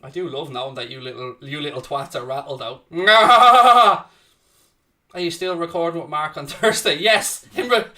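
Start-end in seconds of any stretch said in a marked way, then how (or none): 2.9-4.0 big gloating laugh burst; hearty jeering laugh; loud mocking laugh. Real, not acted. Mocking rival fans.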